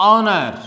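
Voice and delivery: "honor" with no H sound at all; silent H. Shown as a demonstration. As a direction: open "honor" on the vowel, dropping the H. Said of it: "we have pronounced it".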